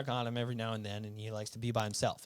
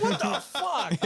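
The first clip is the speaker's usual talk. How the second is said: high, slow, laughing disbelief; cracking up in shock; 7 dB louder, much slower than usual. Retold or imitated. Startled by the horror screen, laughing. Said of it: "And I'm like".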